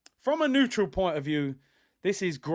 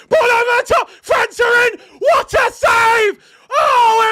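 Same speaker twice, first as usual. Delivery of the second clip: high, loud, near-screaming play-by-play; frantic shouted commentary; 13 semitones higher, 11 dB louder than usual. Real, not acted. Streamer calling the end-to-end chances to his viewers, screaming. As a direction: yell every touch as the chance unfolds.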